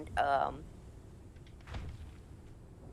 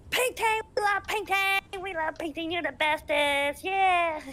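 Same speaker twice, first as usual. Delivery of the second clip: very high, loud mock fan squeal; gushing fan; 12 semitones higher, 6 dB louder than usual. Fan runs up to a beloved character, high excited yelling. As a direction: imitate an overjoyed fan shouting sky-high. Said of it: "this guy running through".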